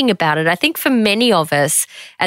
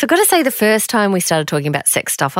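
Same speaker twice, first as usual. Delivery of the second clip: ordinary talk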